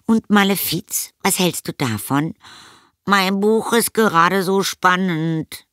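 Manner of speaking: put-on high, strained boy voice; boy making excuses. A narrator's reading. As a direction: plead your way out in a child's high voice. (hohe Jungenstimme mit Ausreden)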